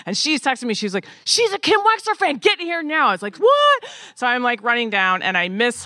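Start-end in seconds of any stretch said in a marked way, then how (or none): 1.2-3.1 urgent friend. Retold or imitated. Costumer's urgent text, voiced. 3.4-3.8 high, loud squeal of surprise; shocked what. Retold or imitated. Hearing her idol is a fan, a loud high squeal. Reacting to news about Carol.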